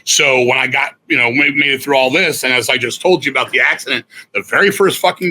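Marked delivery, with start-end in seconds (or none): none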